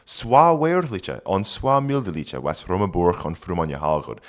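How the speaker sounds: put-on reading Old English aloud; careful Old English pronunciation. Shown as a demonstration. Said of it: "sarcastic remark here".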